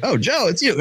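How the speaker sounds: high, light recognition; mock surprise. Recognizing an old friend on the phone, high mock surprise. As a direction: act surprised it's him.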